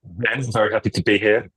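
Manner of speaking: croaky morning voice; rough, croaky early-morning throat. Real, not acted. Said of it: "excuse the, uh, croaky voice".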